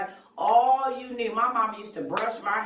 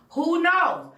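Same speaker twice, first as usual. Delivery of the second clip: high loud burst of who knows; exclaimed question; 11 semitones higher, 6 dB louder than usual. Real, not acted. Emphatic rhetorical exclamation.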